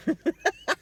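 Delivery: squeaky high burst of laughter; high giggle. Real, not acted. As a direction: giggle at your own creepy face. Laughing at creepy picture.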